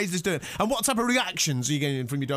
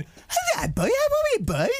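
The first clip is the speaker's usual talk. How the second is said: very high cooing pet voice; hammed-up squeaky greeting to a dog; mock a grown man gushing at a pup; talking to dog. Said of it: "Grown men reduced to going".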